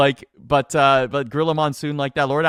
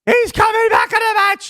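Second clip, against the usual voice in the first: much higher, louder excited call; excited shriek; 8 dB louder than usual. Retold or imitated. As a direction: squeal it like a thrilled commentator. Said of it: "his voice would go up, like, five octaves".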